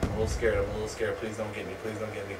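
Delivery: low, hushed little plea; playfully timid, very soft and low; mock-scared pleading. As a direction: murmur it as if hiding. Hides, pleading with the enemy.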